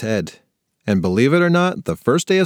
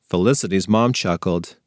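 ordinary delivery

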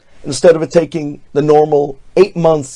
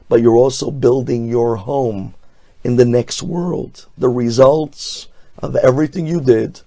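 ordinary talk

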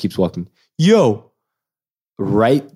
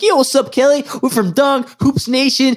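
much higher, fast eager pitch; hyped high greeting. Retold or imitated. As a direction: sell it like an eager promoter. Hyped greeting to Kelly Oubre.

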